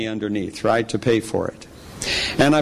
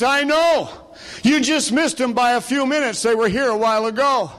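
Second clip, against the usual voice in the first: much higher, light voice for a frightened cashier; cashier's voice; 11 semitones higher than usual. Retold or imitated. Preacher voicing the cashier behind the glass, high and light. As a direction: play the nervous store clerk.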